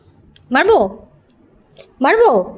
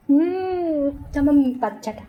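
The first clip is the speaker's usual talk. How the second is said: tender cooing; 5 semitones lower, 7 dB quieter than usual. Real, not acted. Cooing over the sulking pet.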